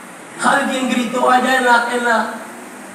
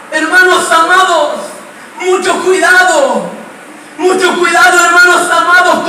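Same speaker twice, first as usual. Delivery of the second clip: urgent warning from the pulpit; preaching at full force; 4 semitones higher than usual. Acted. Preacher warning his flock about fear, preaching at full force. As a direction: drive the warning home.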